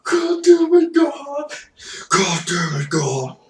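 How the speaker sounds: furious yell. Retold or imitated. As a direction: scream your frustration at the kid. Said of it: "And then I would do".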